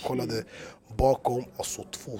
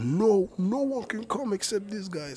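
higher and slow, half-hammed gatekeeper; mock bouncer in English; 9 semitones higher, slower than usual. Rapper guarding backstage from his DJ, mock bouncer voice. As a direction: block the door in English, lightly acted. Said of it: "så jag vaktade och bara".

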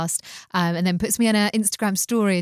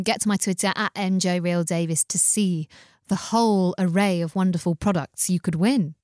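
ordinary talk

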